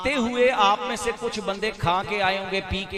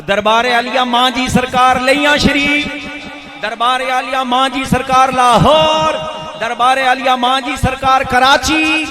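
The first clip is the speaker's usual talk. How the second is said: loud devotional acclamation; ceremonial roll call; 3 semitones higher, 12 dB louder than usual. Real, not acted. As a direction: announce each name like a rallying cry, ringing out the last word. Calls out shrine salutations.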